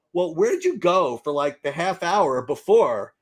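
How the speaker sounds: slow, drawn-out question; lightly voiced bartender, slow and curious; bartender asking. Bartender asks the Irishman where he went, slowly. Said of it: "He's like".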